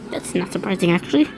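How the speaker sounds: ordinary delivery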